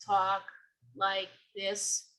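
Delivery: very slow, evenly spaced monotone; robotic, drawn-out monotone. Shown as a demonstration. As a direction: drone it word by word without inflection. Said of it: "they do talk kind of monotone".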